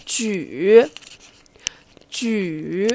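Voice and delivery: 举 with j and rounded ü, dipping tone. Shown as a demonstration. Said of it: "So this one should be".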